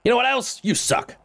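high-pitched mock insult; put-on high gamer tirade; Blighted impression. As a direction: throw it out in a squeaky rant voice. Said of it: "That's my best blighted".

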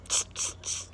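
soft voiceless "tss" repeated; monkey chatter "tss". Acted. Monkeys mimic the peddler, soft tss noises. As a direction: hiss out three short teasing tss sounds. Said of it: "monkeys only shook both their hands back".

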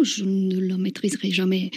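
normal talking voice

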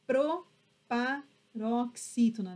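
proparoxítona slowly, pro - pa - roxítona; teacher sounding out proparoxítona in chunks; syllable by syllable. Shown as a demonstration. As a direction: break proparoxítona into measured pieces.

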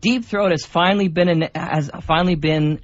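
normal talking voice

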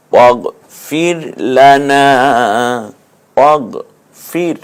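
slow, stretched chanted recitation; Quranic recitation. Shown as a demonstration. Teacher recites the verse line by line for students. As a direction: recite it melodically, phrase by phrase. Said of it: "recites the verse".

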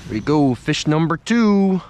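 ordinary delivery

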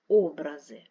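Образи with a loud, long first О; stress on first syllable. Shown as a demonstration. Teacher contrasting word meanings, first-syllable stress on Образи. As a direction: punch the opening vowel of Образи. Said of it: "мають різний наголос".

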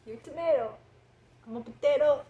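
lightly voiced cartoon vegetables; silly voices. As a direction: keep the goofy cartoon banter going. Silly acted potato/tomato dialogue.

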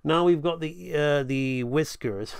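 ordinary talk, nothing marked

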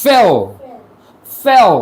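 "ফেও" with the final L barely sounded; light final L. Shown as a demonstration. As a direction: let the L in "ফেও" melt into an o glide. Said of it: "এলটা হালকা করবেন".

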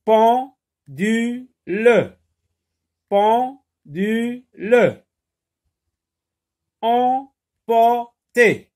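"Pendule" as pen-du-le, "Emporter" as em-por-ter; very slow, high beats on "Pendule" and "Emporter"; syllable by syllable. Shown as a demonstration. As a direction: chop "Pendule" and "Emporter" into evenly spaced syllables. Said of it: "lis les mots en frappant les syllabes".

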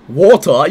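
"water" as "waw-tuh", the British way; mock British "water", no R at the end; mimicked London pronunciation. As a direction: copy a Londoner saying "water", dropping the R. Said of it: "You say water".

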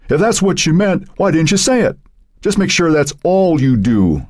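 high-pitched, heated comeback; stern retort. A narrator's reading. Eric putting a teammate in his place, stern retort. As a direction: lay down the law, pitched high.

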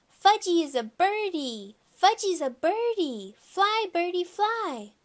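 put-on high, loud toddler crowing; high-pitched childish calling; excited small child. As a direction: crow it like a kid about to fly. Toddler playing at flying.

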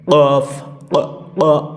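কফ, ক heavy from the back of the tongue; deep back k. Shown as a demonstration. Teacher showing the heavy ক from the tongue root. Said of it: "জিহ্বার গোড়া থেকে একটু এরকম শব্দ হবে".

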